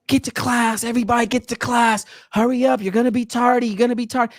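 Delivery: high-pitched imitation of yelling teachers; mock yelling. Teachers sending kids to class after the talk, mock yelling. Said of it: "yelling at 'em".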